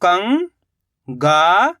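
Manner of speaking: कंगाल drawn out very slowly, sound by sound; slowly stretched syllables. Shown as a demonstration. Teacher sounding out कंगाल slowly before reading it whole. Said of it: "कैसे पढ़ेंगे?".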